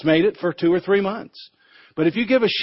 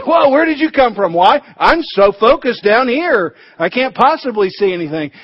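higher, louder startled sheep voice; 5 semitones higher, 5 dB louder than usual. Acted. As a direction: jump as if caught off guard.

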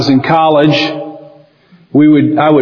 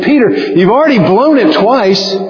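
higher, pleading advice to Peter; urging Peter; 8 semitones higher than usual. Retold or imitated. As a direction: urge him to shut up and leave. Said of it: "you're saying".

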